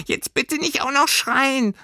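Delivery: very high, pipsqueak creature voice; small creature, pertly pleading; squeaky furry creature. A narrator's reading. Furry creature asks startled girl not to scream, squeaky.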